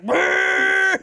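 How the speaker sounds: loud high revving engine sound; engine noise. Retold or imitated. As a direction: make a truck roar off. Imitates truck driving away.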